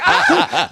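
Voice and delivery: mock panicked shriek; high-pitched scream. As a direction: re-enact your own squealing scream, pitch way up. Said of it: "I scream like a bitch".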